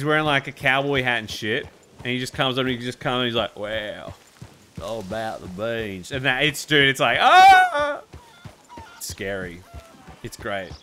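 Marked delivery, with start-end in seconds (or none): 3.6-6.1 lightly voiced low mountain man; low, soft mountain man drawl; gruff mountain man. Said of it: "Donkey do the Mountain Man voice". 7.2-8.0 sudden high, loud shout; mountain man yell. Retold or imitated. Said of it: "Donkey do the Mountain Man voice".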